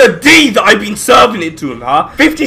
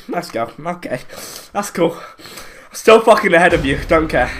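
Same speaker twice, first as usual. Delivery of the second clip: normal talking voice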